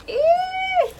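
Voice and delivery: high squeaky ew. Real, not acted. Glittery gummies.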